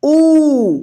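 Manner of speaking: long ऊ; long uu vowel. Shown as a demonstration. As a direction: stretch out the ऊ. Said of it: "बड़ी ऊ".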